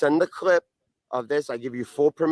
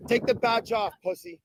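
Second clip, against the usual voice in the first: high, jeering taunts; taunting; 7 semitones higher than usual. Real, not acted. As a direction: jeer at him, pitched up. Taunting a cop, repeated insults.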